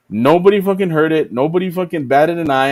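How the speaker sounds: normal talking voice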